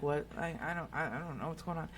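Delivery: a baffled brother trailing off quietly; dazed mumble. Retold or imitated. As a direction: mumble it like a confused brother who knows nothing. Dazed brother voiced in story.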